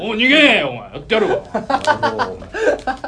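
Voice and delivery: laughing tough-guy comeback; wrestling promo. Acted. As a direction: fire it back like a heel wrestler. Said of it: "プロレスのマイクパフォーマンスみたいになってたから".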